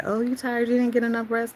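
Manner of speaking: high-pitched imitation of nosy questioning; inquiring coworkers. Coworkers asking about the yawning, high imitated questioning.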